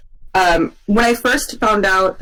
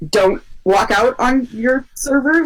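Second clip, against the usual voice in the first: slow, pointed warning; a touch of a scolding finger; stern advice; 3 semitones higher, slower than usual. Real, not acted. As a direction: spell it out slowly to the audience. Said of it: "warning to listeners".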